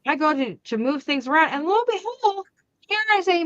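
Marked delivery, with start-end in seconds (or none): none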